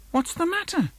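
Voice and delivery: high, concerned question; mum asking. Acted. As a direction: ask the sulky child gently. Mum asking sulky child.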